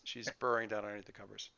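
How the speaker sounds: usual speaking voice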